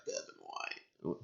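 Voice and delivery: put-on a hushed, creaky old-lady voice, very low and slow; dry little-old-lady impression; imitating grandmother. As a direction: mimic grandma answering in a tiny near-whisper. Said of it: "She's like".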